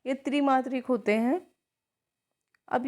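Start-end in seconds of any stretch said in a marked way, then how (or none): none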